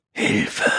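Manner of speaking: breathless, voiceless gasp; gasped cry for help. A narrator's reading. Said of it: "keuchte sie".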